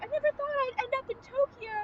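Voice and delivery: lightly voiced young woman, retelling her words; retold girl's lament. Girl lamenting her move to Tokyo, lightly voiced. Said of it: "and she's like".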